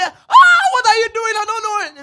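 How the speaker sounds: high, shouted mock objection; hammed-up protest. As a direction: cry out loudly, waving off the thanks. Acted protest at being thanked.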